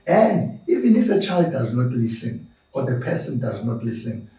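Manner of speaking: ordinary talk, nothing marked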